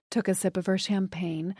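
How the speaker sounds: usual speaking voice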